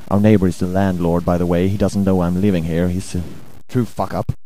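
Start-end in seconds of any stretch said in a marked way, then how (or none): none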